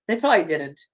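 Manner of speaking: ordinary delivery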